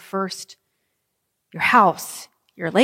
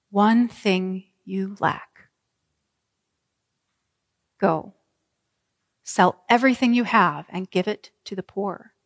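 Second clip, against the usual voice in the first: gentle Jesus. Acted. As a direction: speak as Jesus, lovingly and unhurried. Jesus answers lovingly, sadly.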